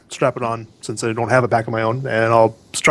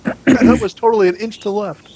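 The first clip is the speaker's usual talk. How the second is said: mock indignant; 8 semitones higher than usual. Mock fussy complaint.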